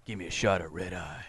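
low, quiet, tough cowboy growl; a hint of a gruff cowboy; badass tough guy. Shown as a demonstration. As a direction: say it low like a hard man. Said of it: "it sounds more badass".

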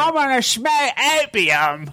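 high, slow hammy Cockney; Cockney accent. Acted. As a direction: drawl it in fake Cockney. Continuing the Cockney accent bit.

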